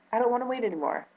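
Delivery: tearful. Real, not acted. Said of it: "I'm probably gonna get emotional".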